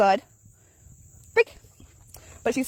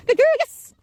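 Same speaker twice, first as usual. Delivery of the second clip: much higher, louder praise for the dog; 9 dB louder, slower than usual. Real, not acted. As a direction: cheer the dog on, sing-song high. Praising her dog on a walk.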